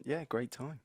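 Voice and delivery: normal talking voice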